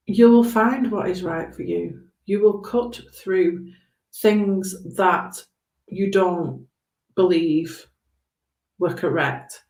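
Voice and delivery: ordinary talk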